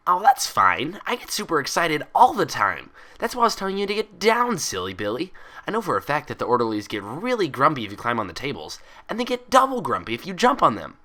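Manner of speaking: loud, high, bubbly chatter; excited Pinkie. A narrator's reading. Pinkie reassuring Twilight, loud and bubbly. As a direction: gush like an overexcited party pony.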